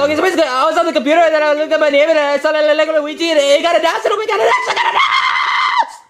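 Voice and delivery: rushed, laughing, climbing to a yell; breathless excitement. Acted. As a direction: blurt it all out excitedly. Luigi bursting with news.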